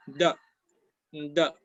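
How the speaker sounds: "D" said as a short Mandarin "de"; Mandarin particle pronunciation. Shown as a demonstration. Teacher showing students how the particle is pronounced, "D" as Mandarin "de". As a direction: say "D" as one short "duh" syllable, not the letter name. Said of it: "Se pronuncia".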